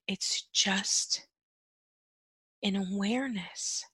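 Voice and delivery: very soft, near-whispered emphasis; quiet, spaced-out reassurance; hushed repeat. Shown as a demonstration. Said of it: "I'm gonna say that again".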